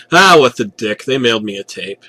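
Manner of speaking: ordinary delivery, nothing marked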